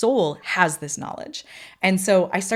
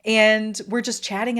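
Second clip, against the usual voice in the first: usual speaking voice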